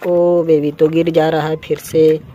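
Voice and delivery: normal talking voice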